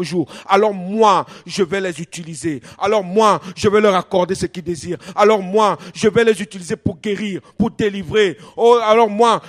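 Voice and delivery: loud, driving sermon delivery with punched phrase openings; fervent preacher proclaiming God's words; impassioned preaching. Real, not acted. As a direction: preach it at full force, building by repetition. Preaches at full force.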